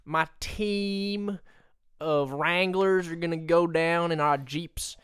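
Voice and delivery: put-on lightly done Southern accent, lawman bluster. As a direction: play a Southern good ol' boy threatening gator thieves. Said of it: "Can you do a southern accent".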